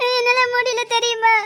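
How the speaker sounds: slow, tearful wail; crying like a child. Acted. Woman tells her lover she cannot cope, crying. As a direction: drag the words out through tears. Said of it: "சின்ன பிள்ளை மாதிரி அழுதுட்டு இருக்காத".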